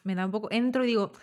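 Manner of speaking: ordinary talk, nothing marked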